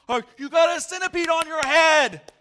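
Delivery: very high, loud warning yell; alarmed shout. Retold or imitated. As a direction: scream the warning. Warning about centipede.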